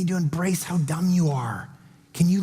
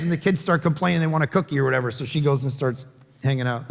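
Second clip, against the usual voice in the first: ordinary talk, nothing marked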